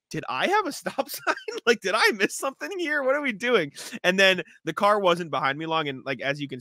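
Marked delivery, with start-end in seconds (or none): 0.1-3.7 high, baffled, self-doubting questions; confused disbelief. Retold or imitated. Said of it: "I'm like".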